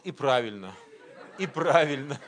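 chuckling as he says it; laughing. Real, not acted. Preacher laughing as he recalls his thought. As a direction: laugh through the words.

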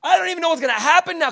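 very high, loud outburst of disbelief; panicked Peter. Acted. Exhausted fisherman answering Jesus's cheerful request, high panicked shouting. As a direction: shriek it in frantic exasperation. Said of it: "Can you imagine the panic".

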